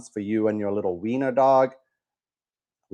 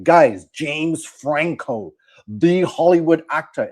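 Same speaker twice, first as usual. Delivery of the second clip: higher, louder, slow-starting self-important announcement; mock serious; 6 semitones higher, 6 dB louder, slower than usual. Host mocking the actor's big reveal, mock serious. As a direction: announce yourself with hammed-up gravity. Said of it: "trying to make it seem all serious".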